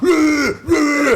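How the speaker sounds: high, loud mock roar; battle roar. Charging at his dad with a bayonet, roaring.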